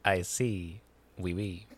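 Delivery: put-on quiet, low French accent, slowly; a soft French Louis voice. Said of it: "that French accent is so good".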